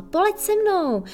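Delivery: high, loud, excited plea; eager call. Acted. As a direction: call out with a big breath, full of hope. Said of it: "Petr se nadechl a zvolal".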